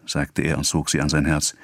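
plain reading voice

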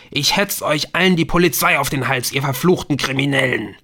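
loud, high-pitched angry shout; furious bellowing. A narrator's reading. Man threatening phone callers with police, bellowing. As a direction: roar it out in outrage. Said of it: "einen Mann am anderen Ende der Leitung brüllen".

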